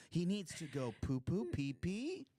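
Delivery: put-on high, sing-song baby talk. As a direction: coo it like to a toddler. Baby talk about the dog.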